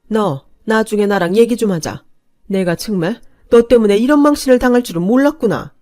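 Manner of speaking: loud, tight, seething warning; angry voice. Acted. Mother-in-law warns the daughter-in-law, loud and angry. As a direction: threaten a talk later. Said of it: "화가 난 목소리로 이렇게 말씀하셨어요".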